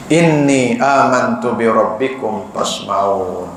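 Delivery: slow, drawn-out Arabic recitation; Quranic recitation. Acted. Preacher quotes the believer's verse, slow chant. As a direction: chant each word slowly.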